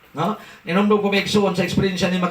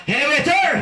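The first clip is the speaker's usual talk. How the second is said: retold shout; 8 semitones higher than usual. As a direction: yell across the eatery for service. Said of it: "Nisinggit ang".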